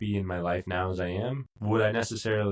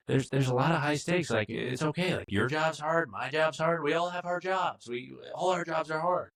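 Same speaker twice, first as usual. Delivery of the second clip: imitated whiny protest; 7 semitones higher than usual. Imitating Matt defending his job.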